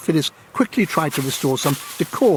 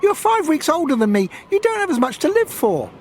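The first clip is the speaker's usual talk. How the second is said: put-on high, bright girl's voice; young girl voice. Girl character dialogue, high.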